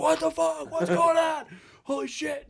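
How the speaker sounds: high, loud startled shout; startled yell. Retold or imitated. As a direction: yell it, jolted awake and shocked. Startled manager wakes up yelling.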